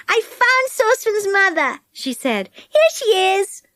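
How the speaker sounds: put-on Connie's bright girl voice. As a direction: speak up high like a keen little girl. Connie announces.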